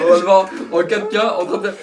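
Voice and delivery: loud laughing tease. Real, not acted. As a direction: tease while cracking up. Teasing while everyone laughs.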